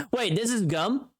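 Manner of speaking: ordinary talk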